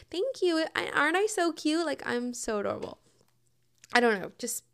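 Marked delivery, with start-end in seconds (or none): 0.1-3.0 higher, sweet mock delight; sugary self-praise. Brushing off a jab about her height, sweet and smug. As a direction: gush about how cute you are. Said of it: "but, like".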